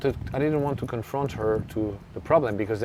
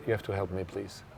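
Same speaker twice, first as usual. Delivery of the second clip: softer and quieter, gently pleading; soft plea; 3 semitones lower, 9 dB quieter than usual. Retold or imitated. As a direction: ask her for help softly, almost begging. Coach pleading with player after match.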